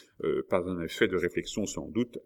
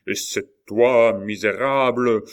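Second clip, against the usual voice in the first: slow, loud, raised denunciation; furious accusation; 3 semitones higher, 8 dB louder, slower than usual. A narrator's reading. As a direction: hurl the insult, drawn out. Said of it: "cria la Mole, dont les yeux flamboyaient". (accusation furieuse, lente et forte)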